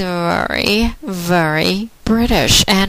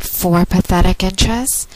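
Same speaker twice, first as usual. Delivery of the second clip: normal talking voice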